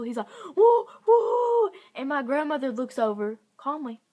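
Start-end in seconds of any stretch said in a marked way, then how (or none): 0.6-1.7 high, loud hooting train whistle; train whistle imitation. Narrator imitating the train conductor's whistle, loud high hoot. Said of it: "he blows his whistle".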